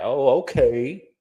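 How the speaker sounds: drawn-out, slow mock "oh, okay" rising on oh; mock casual acceptance. Host mocking Monique's casual reaction, drawn-out and slow. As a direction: imitate a woman shrugging it off, slowly. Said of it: "and you just like".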